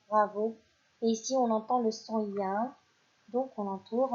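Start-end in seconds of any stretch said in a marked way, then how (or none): none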